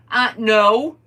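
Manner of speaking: high, very loud no; retold firm refusal. Answering boys who want a ride, emphatic refusal. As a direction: shoot the request down loudly.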